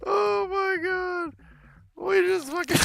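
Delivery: drawn-out, high-pitched disbelief; helpless high squeal. Real, not acted. Player reeling from the silly game, helpless high squeal. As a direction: squeak it out, overcome by the giggles. Still reeling from laughing fit.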